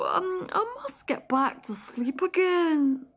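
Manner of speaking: high, chattering woodland squirrel; a sleepy, scatterbrained squirrel, pitched high. Acted. Squirrel advising the worried stoat, sleepy and high.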